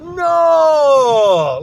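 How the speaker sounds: neighbors' loud, high shout of alarm. Retold or imitated. Neighbors cry out at the speaker's news, loud shout. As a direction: throw the word up like a crowd. Neighbors react, hands thrown up.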